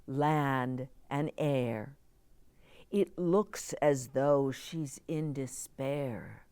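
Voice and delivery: gentle, rhythmic storybook reading; reading to children. A narrator's reading. Reading a picture book poem.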